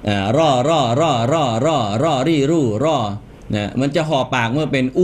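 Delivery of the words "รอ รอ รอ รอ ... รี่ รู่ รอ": "รอ" repeated evenly with lips unpursed; unrounded R drill. Shown as a demonstration. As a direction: drill "รอ รี่ รู่", rounding only on "รู่". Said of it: "อย่าห่อปากที่ตัวรอ".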